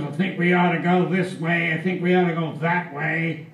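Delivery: lightly hammed-up dithering officer; dithering captain. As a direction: waffle this way and that like a fussy captain. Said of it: "Captain says".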